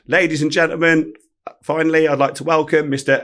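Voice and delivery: a retold MC's welcome; stage announcer. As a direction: announce the star to the crowd. Announcing the star onstage.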